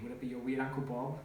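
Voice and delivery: usual speaking voice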